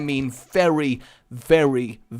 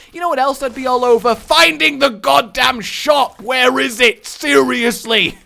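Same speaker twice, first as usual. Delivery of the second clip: higher, loud frustrated outburst; exasperated yelling; 9 semitones higher, 7 dB louder than usual. Real, not acted. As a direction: vent at the game. Frustrated, can't find shop.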